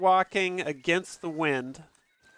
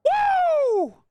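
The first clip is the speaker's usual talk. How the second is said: high, loud whoop of joy; 5 dB louder than usual. Real, not acted. Whooping in joy at the raid. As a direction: let out a joyful whoop.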